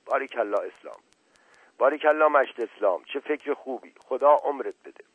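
happy storyteller's cheer; pleased praise. A narrator's reading. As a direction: congratulate him with mild delight. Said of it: "کدخدا خوشحال شد، خندید و گفت".

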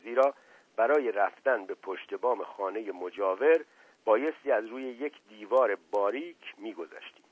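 ordinary delivery

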